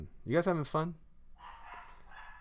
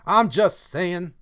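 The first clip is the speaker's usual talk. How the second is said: higher, louder, slow insistence; emphatic repeat; 6 semitones higher, 12 dB louder, slower than usual. Acted. Streamer repeats his point to chat, loud and slow.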